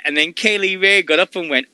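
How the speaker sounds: ordinary delivery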